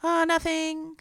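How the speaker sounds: very high, held-up little voice; imitating herself covering up to dad; tearful fake innocence. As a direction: brush it off in a thin high pitch, as if crying. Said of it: "like, crying".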